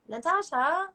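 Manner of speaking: high, lilting call of a name; imitated calling out. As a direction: lightly mimic a nurse summoning someone. Nurse calling out her name.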